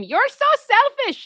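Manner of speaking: high, loud, drawn-out accusing yell; mock scream. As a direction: scream it slowly like an outraged chorus. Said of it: "they just scream".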